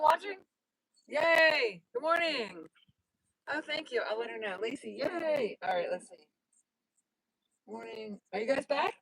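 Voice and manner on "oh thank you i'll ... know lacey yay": ordinary talk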